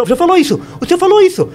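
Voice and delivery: high-pitched insistent interruption; heated insistence. Real, not acted. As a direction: cut in and press the point, pitch leaping up. Interrompe o pastor no debate. (insistência exaltada, em tom agudo)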